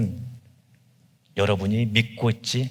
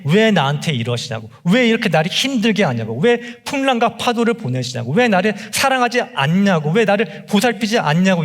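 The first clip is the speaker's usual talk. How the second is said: higher, rising string of anguished why-questions; complaining cry; 7 semitones higher than usual. Retold or imitated. Preacher voicing believers crying out at Jesus, anguished. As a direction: cry out at God like a hurt, abandoned child. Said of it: "이렇게 외치는 것이".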